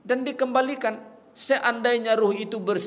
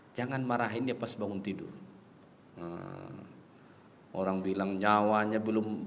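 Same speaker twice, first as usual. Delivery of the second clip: usual speaking voice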